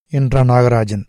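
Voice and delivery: usual speaking voice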